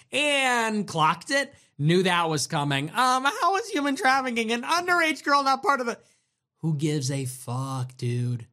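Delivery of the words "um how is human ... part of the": high, whiny imitation of the commenter; mocking parody. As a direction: read it back like a prissy scold. Mock-reading the chatter's question.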